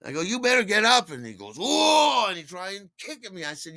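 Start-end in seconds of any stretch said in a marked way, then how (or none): 0.2-1.1 high, loud, urgent command; shouted order. Retold or imitated. Delivery man telling a fallen drunk to move, high shouted order. 1.6-2.2 very high, loud drawn-out howl; mock wordless drunk yell; imitated drunken holler. Drunk trying to kick the delivery man, imitated high holler.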